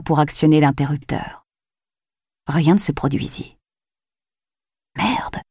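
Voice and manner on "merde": softer muttered swear; murmured curse. Acted. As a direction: curse under your breath. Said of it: "murmura-t-elle". (juron murmuré)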